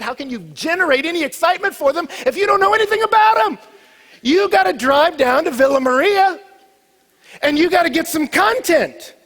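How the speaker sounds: loud, high-pitched pulpit preaching; full-force preaching. Acted. Preacher urging church to go taste, loud preaching. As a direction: drive it home to the whole congregation. Preaches snow cone story loudly.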